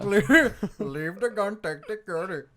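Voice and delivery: put-on Indian-accented mobster line; Indian Don. Indian mobster instructing his partner after a hit, Indian accent. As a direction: give the movie order in an Indian accent. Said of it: "like, an Indian Don".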